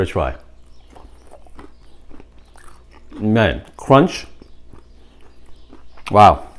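talking while eating; chewing. Real, not acted. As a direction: mouth full of food. Seen 0: Bites chicken at -3, lips closed and working through clip.

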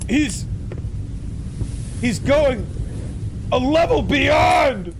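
high, slow excited shout. Real, not acted. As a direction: build to a delighted yell. Hyped reaction to the clip.

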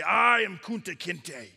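loud, drawn-out proud declaration; defiant declaration. Acted. Chief standing up to those breaking him, defiant. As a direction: stretch the opening word loudly, standing unbroken. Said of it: "he'll keep standing up when they try to break him".

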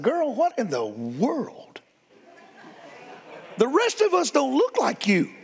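put-on preacher asks the crooked girl what happened, climbing in amused disbelief; amazed disbelief. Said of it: "I said".

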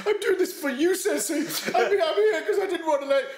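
laughing parody of a tipsy student pledging loyalty; mock drunk emotional. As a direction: ham up a drunk pupil's gushing. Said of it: "he was really emotional".

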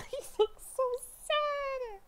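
high, loud fangirl squeal of sympathy; excited squeal. Real, not acted. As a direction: squeal it, overwhelmed by how sad he looks. Reacting to teaser, voice jumps.

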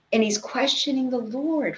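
normal talking voice

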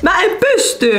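exasperated outcry. Real, not acted. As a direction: blurt it out in exasperation.

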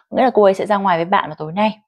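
normal talking voice

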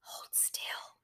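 firm whisper; whispered command. A narrator's reading. Anne-Marie ordering Ellen in a firm whisper. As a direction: order it under the breath. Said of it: "Anne-Marie commanded".

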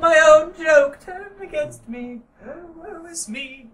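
high mock-British fancy accent; fancy man accent. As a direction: lament in a hammed-up British toff accent. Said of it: "Fancy McFancy Man accent".

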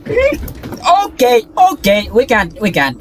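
high panicked gasping; being chased. Real, not acted. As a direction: gasp and babble in fright.

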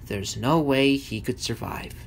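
usual speaking voice

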